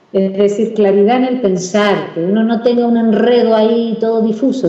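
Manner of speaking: usual speaking voice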